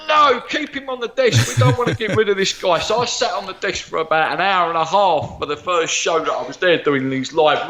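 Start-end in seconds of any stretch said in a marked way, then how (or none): 0.1-2.8 much higher, fast excited insistence; eager producer. Retold or imitated. Producer urging to keep the psychic on air, excited and high. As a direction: keep him on, don't let him go, higher and quicker. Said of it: "my producer was like".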